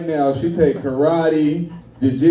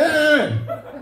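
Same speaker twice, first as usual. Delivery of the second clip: pitched-up mock alarm; alarmed teacher; 10 semitones higher than usual. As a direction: cut in high to stop a pupil. Teacher calling out to stop student.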